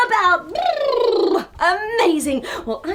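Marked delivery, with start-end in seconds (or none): 0.5-1.5 playful high trill; high brrr sound. Acted. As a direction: trill your lips brightly. Wiggle sound effect.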